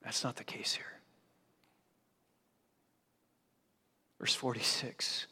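hushed, breathy whisper with no voice. Shown as a demonstration. Pastor showing a dying man's weak voice, whispering. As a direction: drop to a bare whisper for effect. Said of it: "Barely a whisper".

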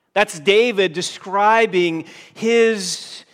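loud, emphatic pulpit voice; impassioned preaching. Acted. Pastor describing David's anguish, preaching with force. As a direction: press the point with urgency.

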